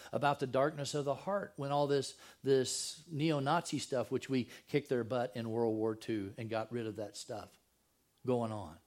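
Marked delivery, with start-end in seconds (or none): none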